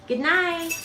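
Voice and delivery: high little imitated bird call; bird saying good night. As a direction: lightly mimic a bird's good night. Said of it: "Your, your Roshi's saying".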